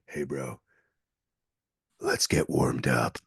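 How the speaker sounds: low, slow mock tough-guy voice; cool stranger. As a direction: drop the voice into a laid-back dude.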